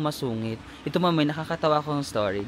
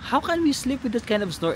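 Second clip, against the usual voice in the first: mock angry yell; 9 semitones higher than usual. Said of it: "parang sumisigaw talaga siya".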